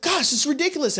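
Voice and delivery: high-pitched exasperation; heated. Retold or imitated. Customer after hours in live chat, heated. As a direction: reenact getting worked up, voice pitched high. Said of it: "I started to get heated".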